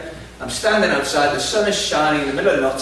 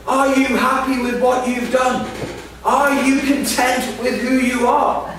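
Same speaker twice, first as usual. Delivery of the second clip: mock shouting woman; 9 semitones higher than usual. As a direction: hurl the questions at him. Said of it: "shouting things like".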